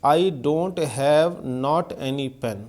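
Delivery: very slow, word by word, I don't have not any pen; slow dictation. Shown as a demonstration. Teacher reading a wrong sentence aloud very slowly. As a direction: spell out each word for the class. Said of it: "देखिए क्या लिखा हुआ है".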